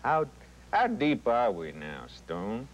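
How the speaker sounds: a hint of the intense leading man; understated Kirk Douglas bravado. Shown as a demonstration. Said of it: "That's Kirk Douglas".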